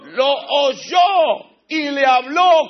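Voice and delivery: slow, loud proclaiming; drawn-out shouted proclamation; preaching shout. Real, not acted. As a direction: proclaim it slowly and loudly. Preacher climax.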